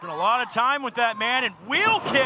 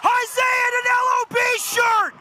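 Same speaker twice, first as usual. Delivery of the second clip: lucha libre announcer; 9 semitones higher than usual. Real, not acted. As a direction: scream the play-by-play. Surprise return in the arena.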